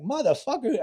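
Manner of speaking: high, sharp imitated cuss from a wired dealer; angry curse. Tweaking dealer cursing at the undercover buyer, angry and high. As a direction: spit it out like an agitated tweaker. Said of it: "he's like".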